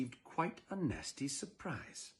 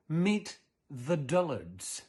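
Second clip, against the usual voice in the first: drawn-out, slow reading of the title; slow title announcement; 2 semitones higher, much slower than usual. Acted. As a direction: savor the title slowly.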